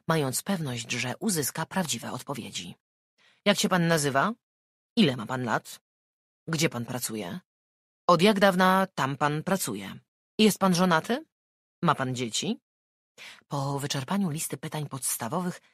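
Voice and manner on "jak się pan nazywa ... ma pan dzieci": detective voice. Acted. Detective voiced asking questions.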